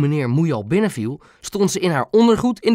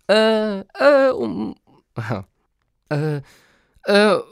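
high, halting string of ers; flustered stalling; 7 semitones higher, much slower than usual. A narrator's reading. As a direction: stall in a high voice while hunting for an excuse. Boy stalls, searching for an excuse. (hoog, hakkelend zoeken naar woorden)

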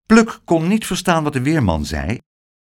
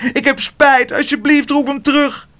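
put-on high, pleading child about to cry; crying plea; 13 semitones higher, 3 dB louder than usual. A narrator's reading. Spijtebijt begging Pluk to call the porter back, crying. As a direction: beg through tears, high. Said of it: "huilde Spijtebijt".

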